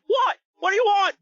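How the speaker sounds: loud, high British squawk; startled mock British yelp; British accent. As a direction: snap back in a high British voice. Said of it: "are you doing a British accent there?".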